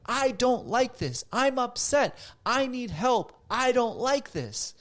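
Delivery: showing calm anger out loud, slow and firm; loud but kind anger. Shown as a demonstration. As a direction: state it loud and plain, without malice. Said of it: "you can still be like".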